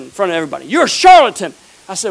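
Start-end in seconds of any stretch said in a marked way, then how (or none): 0.7-1.5 loud accusing shout at the preacher; angry heckler. Retold or imitated. Retelling the heckler yelling at the preacher in front of everybody, loud accusation.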